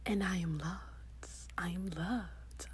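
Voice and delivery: slow whispered affirmation; slow hushed whisper. Acted. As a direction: breathe it out slowly in a whisper.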